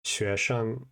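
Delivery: 学生 with a rising then flat tone, clearly; Mandarin demo. Shown as a demonstration. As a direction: say 学生 carefully as a teacher. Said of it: "student is".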